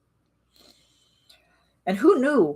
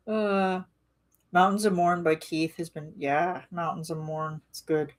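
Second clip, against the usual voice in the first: ordinary delivery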